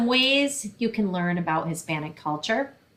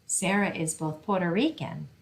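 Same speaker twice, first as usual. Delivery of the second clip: storytime reading. Acted. Librarian reading a picture book to children, lively storytime voice. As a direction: read it brightly to a room of kids.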